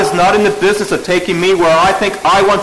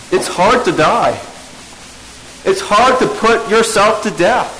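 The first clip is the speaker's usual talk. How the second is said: raised, urgent preaching; emphatic preacher pressing the congregation; 6 semitones higher than usual. Acted. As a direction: drive the point home from the pulpit. Preacher builds to emphatic point.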